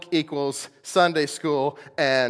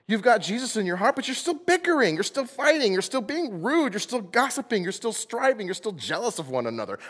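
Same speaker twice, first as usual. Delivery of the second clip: impassioned preaching; 8 semitones higher than usual. Real, not acted. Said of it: "I'm getting excited".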